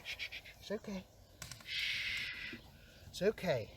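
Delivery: soothing a scared dog, hushed. Real, not acted. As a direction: settle the frightened dog gently.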